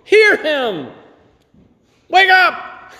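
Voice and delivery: high, slow, urgent plea building to a cry; desperate call to wake up, rising to a shout; pleading outcry. Acted. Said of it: "grab hold of them sometimes and say".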